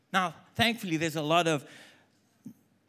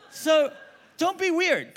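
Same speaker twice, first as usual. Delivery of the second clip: much higher, punching don't be weird; high emphatic punch; 9 semitones higher, 4 dB louder, slower than usual. Real, not acted. Preacher driving home the point, high and emphatic. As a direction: hit it high and sharp.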